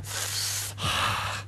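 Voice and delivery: soft drawn-out breathy sigh; long sigh. Real, not acted. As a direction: let out a long shocked sigh. رد فعل على أفلام ثقافية. (تنهيدة طويلة)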